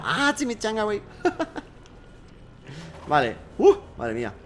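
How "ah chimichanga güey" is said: lilting imitated Mexican accent; playful Mexican Spanish, softer; mock Mexican. Shown as a demonstration. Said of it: "en mexicano".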